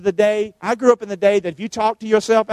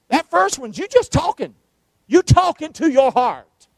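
ordinary delivery

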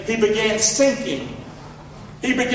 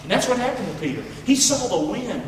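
ordinary talk, nothing marked

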